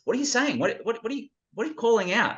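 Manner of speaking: lightly voiced puzzled grown man, pitched high; a confused adult quizzing the kid. Film's Bruce Willis character puzzled by the boy's calling.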